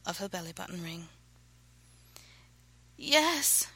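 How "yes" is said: high, louder plea; begging. A narrator's reading. Woman urging her lover on, begging. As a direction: plead with rising urgency. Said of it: "she begged".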